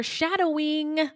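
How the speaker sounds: high, sing-song foreshadowing; mock ominous. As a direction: playfully intone it as a teasing hint. Mock ominous aside to listeners.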